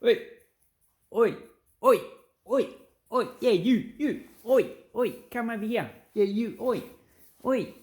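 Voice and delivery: high, loud hailing call; loud high yelling to get a mate's attention. Retold or imitated. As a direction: bark at someone across the room to come over. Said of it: "So, you go".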